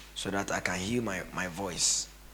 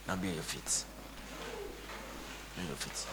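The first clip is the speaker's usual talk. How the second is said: fading off; 3 semitones lower, 12 dB quieter than usual. Acted. Fading closing.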